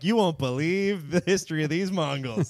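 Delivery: mock clickbait voice, stretched out; clickbait headline. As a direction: sell it like a sensational headline. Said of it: "uses in history of clickbait".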